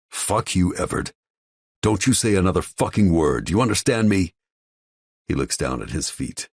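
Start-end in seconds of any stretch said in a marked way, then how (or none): 0.1-4.3 angry. A narrator's reading. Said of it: "the anger in my voice".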